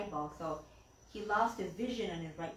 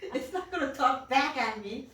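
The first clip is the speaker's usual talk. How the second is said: put-on grumpy old man; 5 semitones higher, 6 dB louder than usual. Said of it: "he was a really grumpy old man".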